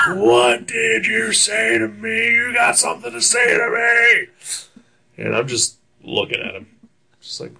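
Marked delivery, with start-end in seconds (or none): 0.1-5.2 furious demand. Retold or imitated. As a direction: drag each word out like a furious dad. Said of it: "Just fucking screaming".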